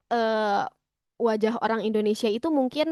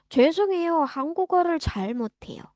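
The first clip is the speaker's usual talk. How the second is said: brisk apology, higher on 죄송해요 then falling away; natural conversational demo; 5 semitones higher, much faster than usual. Shown as a demonstration. Learner answering a Korean person, brisk polite apology. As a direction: run 한국어를 잘 못해요 together quickly. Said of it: "kita bisa jawab kayak gitu aja".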